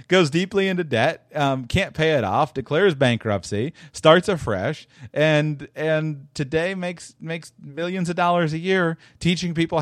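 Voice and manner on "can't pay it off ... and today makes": ordinary talk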